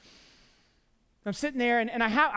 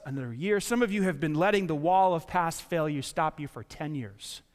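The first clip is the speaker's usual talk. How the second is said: ordinary talk, nothing marked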